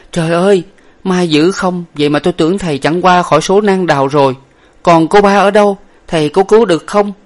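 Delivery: high, loud, breathless-with-news questioning; relieved cry. A narrator's reading. Young lady greeting the survivor, crying out relieved. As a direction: cry out in joyful relief, then ask urgently. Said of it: "chạy lại và la lên rằng".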